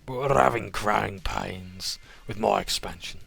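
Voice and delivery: loud, slow, menacing crime boss; smug sewer kingpin with a Dudley accent. Acted. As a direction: lean in like a gangster offering a deal. GM voices the Sewer King.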